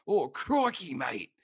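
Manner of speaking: high, hammed-up Aussie exclamation; Steve Irwin Australian accent. Mocking the stock phrase everyone expects, hammed-up Australian accent. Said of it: "pretty much a Steve Irwin thing".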